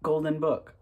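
hammed-up movie-trailer host voice; mock announcer. Vlogger opening his audiobook reading as a grand announcer.

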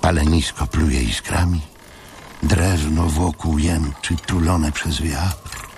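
ordinary delivery

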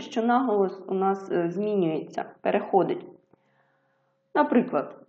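normal talking voice

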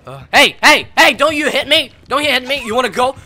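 loud, high challenging shouts; yelling threats. Real, not acted. Gamer yelling at an attacking player, loud taunting threats. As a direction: square up and yell at him. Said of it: "for screaming and yelling".

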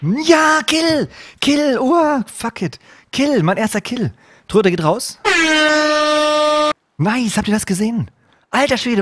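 high, triumphant shouting; real elation. Real, not acted. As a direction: crow over your first kill, voice soaring. Celebrates his first kill.

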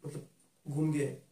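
normal talking voice

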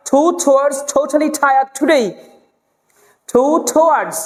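slow "totally tired today" with puffed T's; deliberate, breathy T drill on "two towards"; aspirated T. Shown as a demonstration. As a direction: release every T in "towards totally tired" with a burst of air. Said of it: "এটাকে towards পড়ে".

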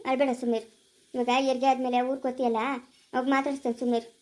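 low, soft consoling voice; soothing. Acted. Elder woman calming the crying expectant mother, soothing. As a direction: gently hush someone who is crying.

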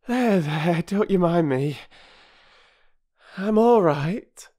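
high, loud, comforting housewife; mother reassuring the children after crying; reassuring after tears. Acted. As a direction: brush off the tears. Mrs Perks recovering.

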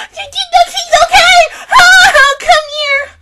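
high, loud shout breaking into happy tears; overwhelmed, sobbing cheer at the screen; crying happy shout. Real, not acted. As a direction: cheer through real tears of joy. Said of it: "I'm gonna cry".

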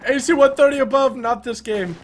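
high, loud alarmed yell; panicking gamer. Real, not acted. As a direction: panic at the gunship overhead. Enemy gunship overhead.

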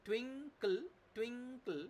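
টুইঙ্কল in two beats, টুইং then কল, high pitch; syllable division. Shown as a demonstration. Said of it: "আমি এবার দেখি". (টুইঙ্কল দুই সিলেবলে ভেঙে, উঁচু স্বরে)

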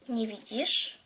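ordinary talk

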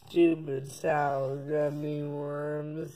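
slow, hushed and distant; slow soft flashback. Shown as a demonstration. Kid replaying his own words as a flashback, slow and hushed. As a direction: replay it softly like a fading memory. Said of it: "Rewind the clip".

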